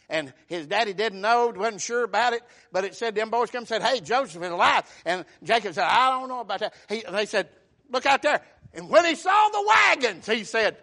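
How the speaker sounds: loud, animated Bible storytelling; full-voiced pulpit storyteller; impassioned preaching. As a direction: preach the story with rising force.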